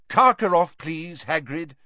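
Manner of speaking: high, loud, sharp reminder; sharply. A narrator's reading. Dumbledore sending Hagrid on his errand, sharply. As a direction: cut in firmly, slowing on the please. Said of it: "said Dumbledore sharply".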